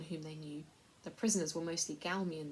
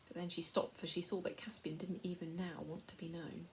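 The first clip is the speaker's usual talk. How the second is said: plain reading voice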